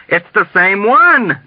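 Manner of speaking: high, drawn-out insistence; emphatic shout. A narrator's reading. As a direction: shout it slowly to drive it home. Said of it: "shouted at him emphatically".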